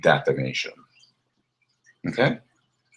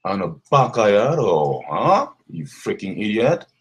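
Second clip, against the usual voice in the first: ordinary talk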